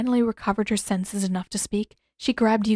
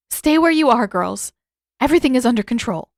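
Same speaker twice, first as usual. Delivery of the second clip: louder, rushed call back; unconvincing call; 3 semitones higher, 6 dB louder than usual. A narrator's reading. Amik calling back to the girls in the other room, loud and unconvincing. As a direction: announce calm you clearly don't feel. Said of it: "nothing even remotely convincing in Amik's tone".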